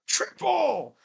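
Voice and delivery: high, drawn-out exclamation; high celebratory call; exclaimed call. Real, not acted. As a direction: sing out "Triple!" high, falling at the end. Word repeated as an exclamation.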